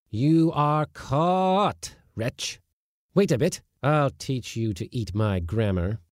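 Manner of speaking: high, slow gloating over a trapped mouse; gloating schoolmaster. A narrator's reading. Schoolmaster gloating over a caught mouse, high and slow. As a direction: savour the catch like a cruel teacher. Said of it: "Having gloated over his victim".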